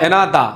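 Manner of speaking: এনাদা ending in an open "da", no R; non-rhotic model of এনাদা; British silent R. Shown as a demonstration. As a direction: drop the R at the end of এনাদা. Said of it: "লাস্টের এ আর কে উচ্চারণ করে না".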